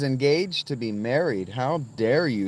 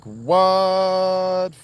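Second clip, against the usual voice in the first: imitating her; 8 semitones higher, 6 dB louder than usual. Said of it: "She's like".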